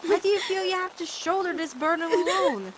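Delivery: usual speaking voice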